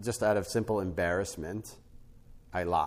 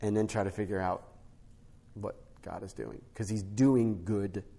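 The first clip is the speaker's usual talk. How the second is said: usual speaking voice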